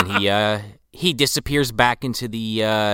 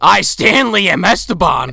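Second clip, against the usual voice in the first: higher, louder mock possession; possessed Stanley; 6 semitones higher, 6 dB louder than usual. Stanley possessed by Esteban, mock proclamation. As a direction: proclaim it like a hammed-up demon. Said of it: "when he gets Vigo-fied".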